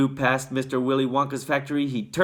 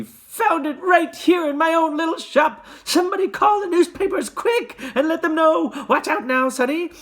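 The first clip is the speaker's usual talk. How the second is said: excited shouting; 6 dB louder than usual. A narrator's reading. Shopkeeper keeps shouting.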